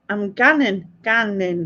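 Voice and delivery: "ganning" with a short a, for going; dialect ganning. Shown as a demonstration. Correcting a housemate's word, "ganning" said distinctly in dialect. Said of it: "No, what? I'm going?".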